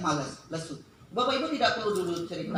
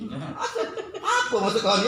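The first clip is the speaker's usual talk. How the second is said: puzzled demand; 2 semitones higher than usual. Retold or imitated. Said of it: "tidak tahu maksudnya apa dia ini".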